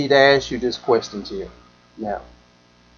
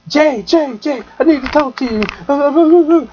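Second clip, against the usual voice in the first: high, slow, frantic call for help; a hint of a distraught friend; imitating her crying call; 11 semitones higher, 3 dB louder, slower than usual. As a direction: plead like a woman in tears on the phone. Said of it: "just crying and going off".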